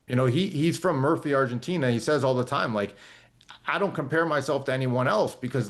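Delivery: usual speaking voice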